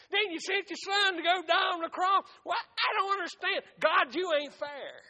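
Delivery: high, forceful protest in a preacher's voice; devil's complaint. Acted. Devil accusing God of being unfair, loud complaint. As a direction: argue indignantly with God, pitched high. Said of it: "the devil's been saying".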